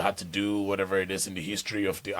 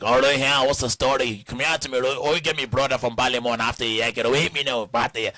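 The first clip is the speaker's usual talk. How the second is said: louder, don't-mess-with-me Dublin accent; proper Dublin accent; 3 semitones higher, 5 dB louder than usual. Shown as a demonstration. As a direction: imitate a streetwise proper Dub. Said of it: "you don't wanna mess around with the proper dub".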